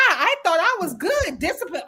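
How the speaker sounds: ordinary talk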